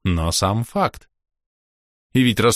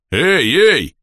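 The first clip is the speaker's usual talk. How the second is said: louder, higher interjection; calling a halt; 10 semitones higher, 7 dB louder than usual. A narrator's reading. Half-orc interrupts his boss giving secrets away, protesting loudly. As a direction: cut in loudly to stop them. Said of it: "замахал руками Бом".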